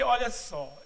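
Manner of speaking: normal talking voice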